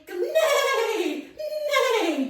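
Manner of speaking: loud high neigh; whinny like a galloping horse. Shown as a demonstration. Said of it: "the horse sees it and goes".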